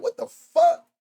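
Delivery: high yelping outburst; can't believe it broke again; exasperated shock. Real, not acted. Streamer whose camera fails again, high exasperated yelp.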